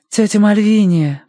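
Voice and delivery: slow, tender call; gentle call. A narrator's reading. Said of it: "позвала она ласково".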